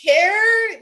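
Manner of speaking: high, loud, indignant; angry shout. Real, not acted. Caller demanding who cared, loud and high. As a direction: spit out the last word.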